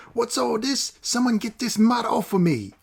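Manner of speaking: high, panicked thug shout; rough yell. Acted. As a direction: yell at the dog. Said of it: "The man yelled".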